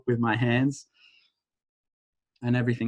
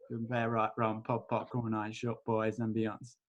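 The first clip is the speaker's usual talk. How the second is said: rattled-off ritual formula, much softer; tossed-off chant, dropping away; quick mumbled incantation; 3 semitones lower, 11 dB quieter than usual. Acted. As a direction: rote clearing phrase, murmured. Recites clearing statement.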